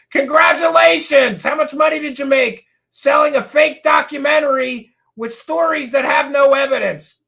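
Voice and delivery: loud, high, scornful congratulations; real heated sarcasm at full volume; sarcastic shouting. Real, not acted. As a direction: shout it as an indignant challenge. Sarcastic shouted challenge to filmmaker.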